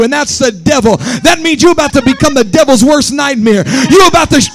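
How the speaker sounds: loud, driving sermon delivery; full-force preaching. Acted. Pastor preaching against the devil, loud and driving. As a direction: hammer it home to the church.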